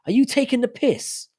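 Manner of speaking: high-pitched, incredulous British challenge; mock indignation. Streamer showing a viewer the British phrase, mock indignant. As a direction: act affronted, pitch jumping right up.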